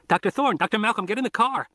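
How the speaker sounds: put-on teen boy warning the doctors over the radio, high and urgent; urgent kid on the radio. A narrator's reading. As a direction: play the boy pleading to get in the car.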